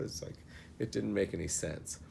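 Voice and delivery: ordinary talk, nothing marked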